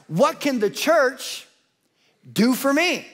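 ordinary delivery

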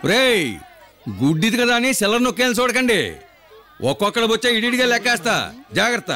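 high-pitched threatening scold; angry warning. Acted. Beggar boss warns the crowd.

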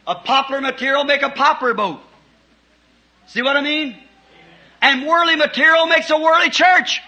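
rising to a shouted climax; fiery preaching. Real, not acted. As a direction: hammer the point home from the pulpit. Preaching at full force.

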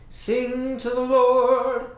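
slow, loud call to praise; joyful shout. Acted. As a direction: call everyone to sing. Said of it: "the Israelites shouted".

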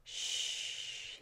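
soft whispered shh; shushing. A narrator's reading. Sir Small hushing Lady Tina beside the sleeping dragonfly, whispered. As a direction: hush her without waking anything. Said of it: "he said to Lady Tina".

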